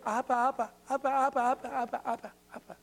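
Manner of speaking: lightly voiced toddler babble; a hint of a small child calling; imitating a baby saying Appa; baby babbling. Shown as a demonstration. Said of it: "it sounds like this".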